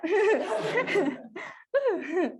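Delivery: high laugh; loud, high embarrassed laugh; breathless laugh. Real, not acted. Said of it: "the mask is hot".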